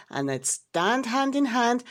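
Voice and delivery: plain reading voice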